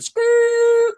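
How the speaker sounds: high, loud mock tire screech; skid noise. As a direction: screech it like a skidding car. Imitating a car skidding.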